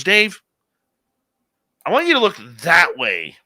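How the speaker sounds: high, loud urgent call to Dave; Samantha, high and loud, tells Dave to look north. Retold or imitated. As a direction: urgently order him to look.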